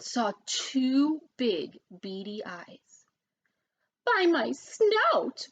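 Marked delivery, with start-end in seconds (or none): 4.0-5.5 high, slow startled cry; cried out. A narrator's reading. Pig spotting the wolf at the window, crying out. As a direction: gasp it out in alarm. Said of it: "cried Horace".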